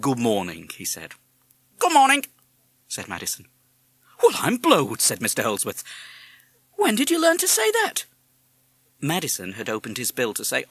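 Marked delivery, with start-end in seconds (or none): 1.8-2.2 high, loud parrot voice; parrot mimic. A narrator's reading. Parrot echoing the father's greeting, squawky mimic. 4.2-5.0 high, loud amazement; astonished. A narrator's reading. Father hearing the parrot talk, astonished. 6.8-8.0 very high, loud astonished question; amazed. A narrator's reading. Father questioning the talking parrot, amazed.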